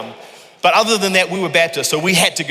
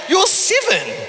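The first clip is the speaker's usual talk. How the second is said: much higher, mock-indignant disbelief; incredulous retort; 12 semitones higher, slower than usual. Dad answering his seven-year-old daughter, mock-indignant and much higher. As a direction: play the outraged dad, pitch jumping way up.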